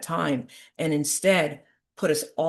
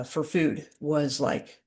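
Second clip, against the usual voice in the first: normal talking voice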